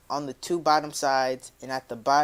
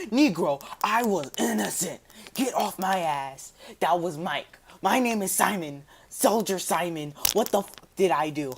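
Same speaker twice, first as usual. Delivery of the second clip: indignant protest; 4 semitones higher than usual. Pretend. Imagined protest to police.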